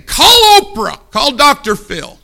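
mocking preacher cry; sarcastic shout. Real, not acted. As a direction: scoff loudly at self-pity.

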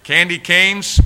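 Candy canes called out high and loud; loud exclaim. Retold or imitated. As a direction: proclaim Candy canes like a grand find. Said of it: "I'm going like this".

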